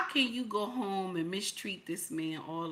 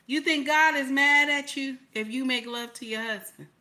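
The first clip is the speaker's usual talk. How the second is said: ordinary delivery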